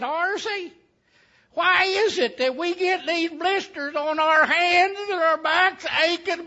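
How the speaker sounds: high, loud, aggrieved questioning of a brother; indignant complaint. Acted. Ditch digger complains to his brother about Tom in the shade, indignant. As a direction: build from a high whine to a loud protest.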